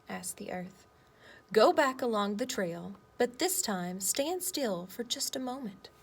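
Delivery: lively picture-book read-aloud with voiced boy and earth; reading to children. Teacher reading a story to her class, lively read-aloud.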